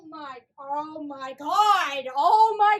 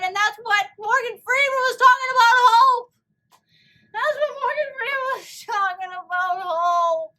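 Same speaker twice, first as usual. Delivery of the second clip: loud, tearful cry; crying outburst; 5 semitones higher, 5 dB louder than usual. Real, not acted. Viewer crying loudly about the theme of hope.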